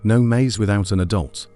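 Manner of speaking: plain reading voice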